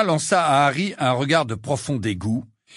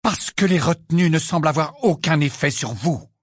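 loud, higher, cutting reply; cutting retort; 6 semitones higher, 5 dB louder than usual. A narrator's reading. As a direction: cut the pupil down sharply. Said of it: "répliqua le professeur McGonagall d'une voix tranchante".

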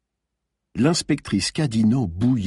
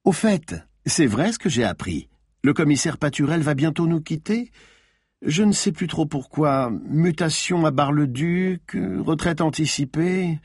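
snake-cold insinuation, higher; cold insinuating; 6 semitones higher than usual. A narrator's reading. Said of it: "avec la froideur d'un serpent".

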